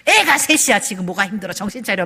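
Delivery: mock scolding, loud at the start; dismissive scolding. Wife dismissing her tired husband, mock scolding. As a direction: brush the complaint off with an acted telling-off. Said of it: "남편을 막 무시하고".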